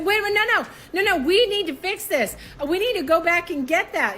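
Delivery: retold panic; panicked pleading. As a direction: beg to fix it. Pleading to undo marriage.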